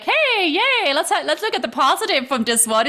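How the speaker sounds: high chirpy mock optimist; a sunny Pollyanna, sung out high; mock cheerful. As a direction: play up a bubbly optimist. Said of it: "a sign that's just like".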